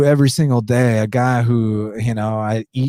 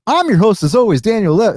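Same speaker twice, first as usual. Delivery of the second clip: much higher and louder, full energetic podcast intro; old hype host voice; 11 semitones higher, 4 dB louder than usual. Shown as a demonstration. Host showing fans his old booming intro, high and loud. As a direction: blast it out like the usual show opener. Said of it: "you guys know how I used to talk".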